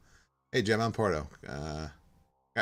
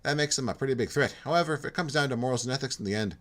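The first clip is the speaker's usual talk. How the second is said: light Irish lilt on a friendly loner; a hint of Irish brogue; Irish-tinged accent; 4 semitones higher, 5 dB louder, faster than usual. Jim admitting to Porto he likes being alone, lightly Irish. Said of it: "my Minnesota just turned Irish".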